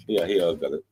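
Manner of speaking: ordinary talk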